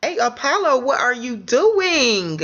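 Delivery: high, drawn-out scolding question; exaggerated disbelieving challenge; mock call-out. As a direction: call him out, stretching the last word.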